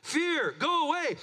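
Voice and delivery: high, loud rebuke of fear; a preacher's shouted rebuke; commanding shout. Acted. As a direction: drive fear away with a ringing command. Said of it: "trying to blow fear away".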